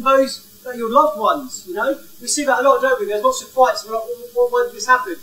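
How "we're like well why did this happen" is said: ordinary delivery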